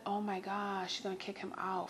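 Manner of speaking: softly to herself, hopeful; half under breath, eavesdropping on the neighbours; hushed relieved thought. Retold or imitated. Neighbour hoping the girl kicks him out, hushed.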